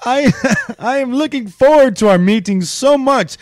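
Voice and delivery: mock Russian teen. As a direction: gush it out in a playful Russian accent. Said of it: "she said".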